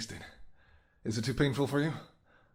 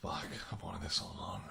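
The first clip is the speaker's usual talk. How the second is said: whisper; 5 semitones lower, 7 dB quieter than usual. Acted. Feeding on hunter.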